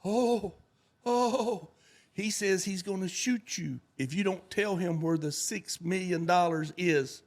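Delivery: high, fretful relay of the threat; mock panic, voice up high; hammed-up scared go-between; nervous interpreter. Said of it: "So the interpreter says".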